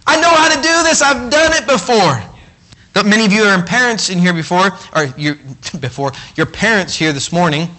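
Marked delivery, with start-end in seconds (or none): 0.1-2.2 high, slow and gentle reassurance; reassuring Jesus. Acted. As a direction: coax a scared person to trust you. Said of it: "the Jesus is saying".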